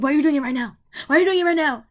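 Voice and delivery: high, loud mocking mom nag; nagging mom yell. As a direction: bark it like a scolding mother. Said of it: "my mom's like".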